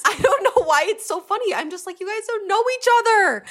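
high, laughing incredulity; hysterical laughing. Real, not acted. Host laughing at the expense of their tears, hysterically amused. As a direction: squeal it through real laughter. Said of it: "hysterically laughing over the expense of their tears".

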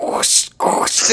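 soft breathy whoosh, like water sloshing in a shoe; sloshing noise. Retold or imitated. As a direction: mimic wet feet squelching, mostly air. Imitating water sloshing in shoes.

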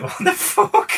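very high-pitched mock shock; retold astonishment. Storyteller reacting as [name] walks in, astonished. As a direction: react in exaggerated high disbelief.

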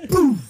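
loud, high burst of a boom; imitated explosion. Mimicking mom blowing up, explosive boom. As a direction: burst it out like a blast.

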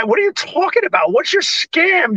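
high-pitched incredulous challenge; mock indignation. Driver challenging the scratch repair guy, high mock indignation. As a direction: pitch it up in comic suspicion.

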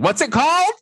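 high, puzzled Kathy voice; Kathy asking. Retold or imitated. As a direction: squeak it out high like a baffled Kathy. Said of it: "Kathy said".

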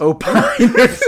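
laughing while saying the word. Real, not acted. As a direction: burst into laughter. Repeating funny anagram laughing.

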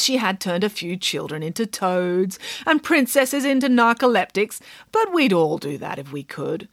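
ordinary delivery

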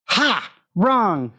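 high, triumphant "gotcha"; mocking laugh. A narrator's reading. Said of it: "Christina laughed".

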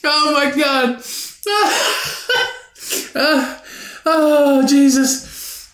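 high, weak from real laughter; laughed out. Real, not acted. Storyteller recovering from his own impression, high laughing exclamation. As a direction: sigh it out after cracking up.

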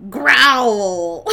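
loud, drawn-out mock werewolf growl; word spoken as growl. Out-of-practice werewolf trying to scare Bambi, loud mock growl. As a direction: say the word instead of really growling, loud and stretched. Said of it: "he says the word fucking growl".

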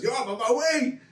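high-pitched, eager announcement; excited caller. Retold or imitated. Acting out Johnny's excited FaceTime call.

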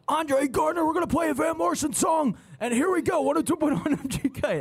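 high, shouted classic-rock DJ patter; Andre Gardner radio impression. Host mimicking the afternoon drive DJ, high radio hype voice. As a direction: hype the next song like an over-the-top radio host. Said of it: "That's how he sounds".

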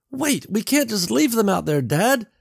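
high-pitched daughter's plea; pleading. A narrator's reading.

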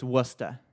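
Worcester as WUSS-tuh, two syllables; Worcester shortened to WUSS-tuh; local pronunciation. Shown as a demonstration. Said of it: "You're not saying it right".

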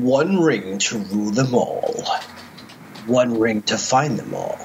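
slow, portentous verse reading; dramatic recitation. Acted. Reciting the Ring verse to his co-host, slow dramatic intoning. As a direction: draw out each word of the incantation.

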